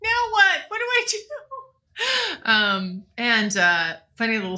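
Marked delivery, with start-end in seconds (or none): 0.0-1.2 high, alarmed mock panic. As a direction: act out a freakout, pitched up. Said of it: "I was like".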